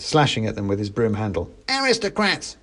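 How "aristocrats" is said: mock fighting shout. A narrator's reading. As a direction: hurl it out as the little soldier. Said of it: "he shouted".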